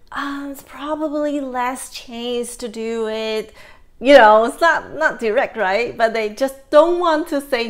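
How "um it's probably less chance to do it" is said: softer, hesitant, hedging voice; soft, hesitant refusal. Retold or imitated. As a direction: gently dodge saying no. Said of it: "but say".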